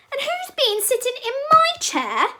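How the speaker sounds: very high, loud tiny bear yell; Teeny Weeny Bear. A narrator's reading. Little bear finds his chair broken, teeny weeny yell. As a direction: squeal it, drawing out the ending. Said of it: "yelled Teeny Weeny Bear in his teeny weeny voice".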